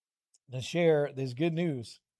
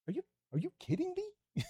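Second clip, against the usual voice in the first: are you kidding me pushed much higher in squeaky astonishment; stunned disbelief; 8 dB quieter than usual. Real, not acted. Preacher to the kids, hammed-up astonishment at Paul. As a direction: go way up on kidding, can't believe it.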